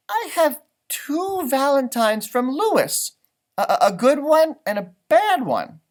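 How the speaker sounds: a hint of a puzzled little girl; Margaret's voice. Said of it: "Margaret ... said".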